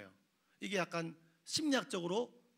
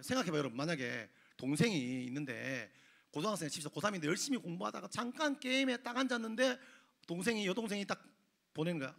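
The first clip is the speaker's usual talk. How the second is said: ordinary talk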